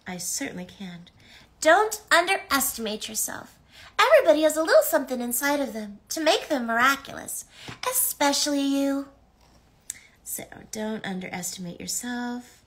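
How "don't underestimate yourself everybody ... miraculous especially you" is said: high, bright cartoon sprite voice; bubbly high character voice; Tikki voice. Reads message in character voice.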